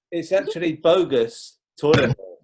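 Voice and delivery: ordinary talk, nothing marked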